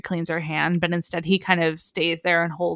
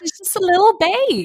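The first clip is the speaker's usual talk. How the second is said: cooing voice; 12 semitones higher than usual. Real, not acted. Cooing over the character.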